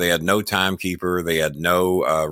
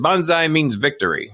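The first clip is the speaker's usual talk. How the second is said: higher-pitched mock Japanese accent; raised, put-on Japanese accent; fake Japanese accent; 6 semitones higher than usual. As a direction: imitate the wrestler in a light Japanese accent. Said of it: "fake Japanese accent".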